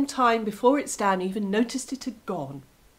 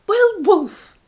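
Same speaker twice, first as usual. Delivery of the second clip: high, loud fox voice; scolding fox; 10 semitones higher, 11 dB louder than usual. Acted. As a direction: tick him off like a sly vixen. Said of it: "said the fox".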